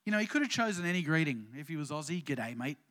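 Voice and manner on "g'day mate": mock Australian accent, jokey and low. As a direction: toss off a silly Aussie "G'day, mate". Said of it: "I'll stop doing silly accents now".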